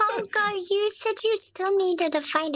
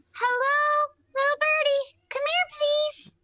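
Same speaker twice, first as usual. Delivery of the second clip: coaxing a bird; 9 semitones higher than usual. Acted. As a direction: coax the bird gently.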